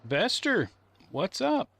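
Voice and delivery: high, loud happy call; cheerful high-pitched hello to a pet; excited greeting. Real, not acted. Calling out to pet.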